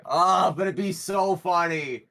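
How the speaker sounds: gleeful pleading. Real, not acted. Player torn over the paid gig, excitedly pleading. Player tempted, then exclaims the same.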